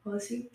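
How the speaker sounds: [name] ending in ee; dialect name change. Shown as a demonstration. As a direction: say [name] as a-LISS-ee. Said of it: "my name from [name] would be".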